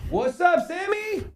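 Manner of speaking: high, excited greeting. Acted. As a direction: call out to the new viewer. Greeting new viewer.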